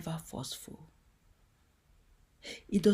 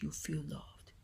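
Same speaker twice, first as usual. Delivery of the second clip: softer, whispered reassurance; Papa's gentle voice; 5 semitones lower, 11 dB quieter than usual. Retold or imitated. Papa reassuring the listener, in a soft tender whisper. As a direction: breathe it tenderly. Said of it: "But Papa says".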